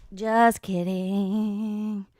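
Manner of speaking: "Just kidding" stretched out very slowly; slow teasing drawl; drawn-out just kidding. Pretend. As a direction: drag the words out lazily.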